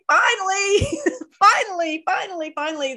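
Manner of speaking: high, elated repeats of finally; excited relief. Retold or imitated. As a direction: burst out with joyful relief. Excited reaction to being noticed.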